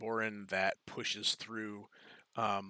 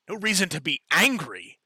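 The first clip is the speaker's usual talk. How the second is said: loud, higher mocking repeat of the words; mocking echo; 7 semitones higher, 8 dB louder than usual. The human head mocks the adventurer's words, sneering. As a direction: throw the phrase back at her, sneering. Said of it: "the human head says".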